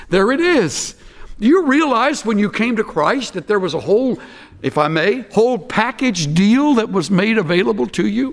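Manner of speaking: ordinary talk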